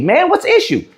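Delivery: high, mocking "just leave" brush-off; high sarcastic mimicry of a know-it-all; mocking dismissal. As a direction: sneer it like a smug dismissal.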